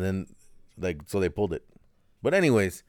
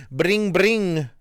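high, louder singsong ringing; phone ring imitation; 9 semitones higher, 6 dB louder than usual. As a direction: chime it like a phone. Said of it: "I tell my kids".

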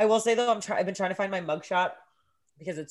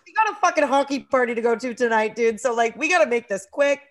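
loud, raised retold urging; brash impatience; 5 semitones higher, 8 dB louder than usual. Student picking up roommate at jail, brash impatience. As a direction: hurry them along loudly.

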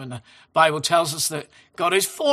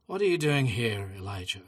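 softer, hushed question from God; God's voice as a gentle whisper; 8 semitones lower, 7 dB quieter than usual. A narrator's reading. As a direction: let it fall soft and gentle. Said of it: "came a gentle whisper".

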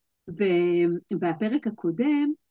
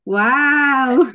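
loud, high, drawn-out wow; excited wow; 5 semitones higher, 9 dB louder, much slower than usual. Real, not acted. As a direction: burst out with a long, rising wow. Enthusiastic opening welcoming a guest. (וואו נלהב וממושך)

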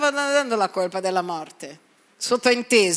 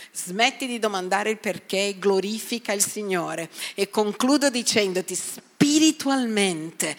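ordinary talk

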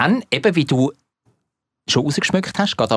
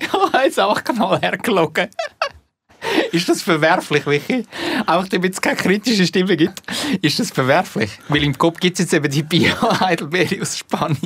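laughing; 8 semitones higher than usual. Real, not acted. Laughing self-mocking confession.

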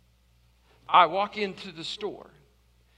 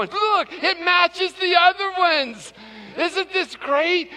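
thrilled clerk; 9 semitones higher, 4 dB louder, slower than usual. Retold or imitated. Clerk thrilled with new wheel.

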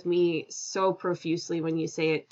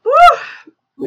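excited whoop; 14 dB louder than usual. Real, not acted. Guest cheers after laughter.

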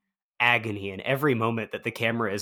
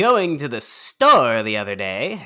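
louder, showy comedian delivery; stage voice; 5 semitones higher, 6 dB louder than usual. Shown as a demonstration. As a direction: do a hammy stage voice. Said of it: "like a stage voice".

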